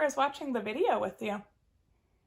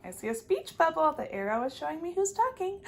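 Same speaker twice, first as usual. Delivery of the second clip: plain reading voice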